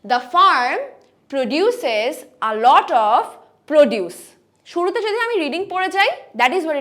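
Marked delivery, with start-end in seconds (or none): 0.0-4.2 loud, very slow model reading; example read aloud. Shown as a demonstration. Teacher reads an example sentence, slow and emphatic. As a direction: read it out in slow separate chunks. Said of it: "একটা example দেখি".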